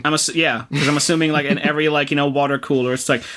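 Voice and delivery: ordinary talk, nothing marked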